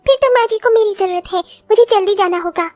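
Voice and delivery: put-on high voice of a young daughter-in-law offering help. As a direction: lift the voice into an eager young bride. Daughters-in-law talking together.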